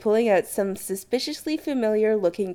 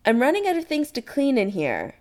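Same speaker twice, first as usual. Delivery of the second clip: higher-pitched, mild call; 6 semitones higher than usual. Acted. Kim to the boys from the kitchen, mildly calling. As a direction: lightly call from another room. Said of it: "Kim calls from the kitchen".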